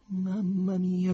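slow, high-pitched dismay; drawn-out alarm, as a thought to himself; frightened thought. A narrator's reading. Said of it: "pensò spaventato Ryuhin". (spaventato, voce acuta)

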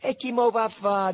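high, loud challenge. A narrator's reading. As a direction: throw the question back loudly. Diver answers amid shouted challenges. (replica ad alta voce, acuta)

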